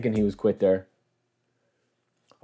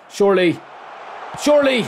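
two high cries, the second higher and louder; rising excitement; 10 semitones higher, 4 dB louder than usual. Real, not acted. Gamer willing his shot in, rising excited cries. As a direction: build from hope to a yelp.

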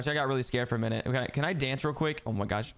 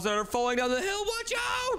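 rising high-pitched alarm; alarmed warning. Real, not acted. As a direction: build to a squealed warning. Warning during a snowball race.

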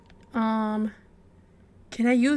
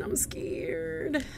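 whispered fearfully; scared whisper; 8 dB quieter than usual. Real, not acted. Player hiding from a monster, scared whisper. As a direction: breathe it out in a frightened whisper.

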